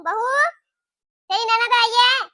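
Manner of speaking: loud call across the house. Acted. Mother calling her daughter-in-law loudly to greet the guest. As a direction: shout for the bahu to come.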